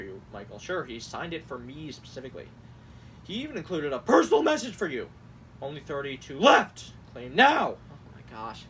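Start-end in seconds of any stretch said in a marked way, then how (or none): 4.0-7.8 sudden loud bursts on the capitalized words; mock all-caps shouting. Host reads campaign texts to mock them, shouting the capitals. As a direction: belt it out like an overexcited ad. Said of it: "certain things that, like, is being all caps".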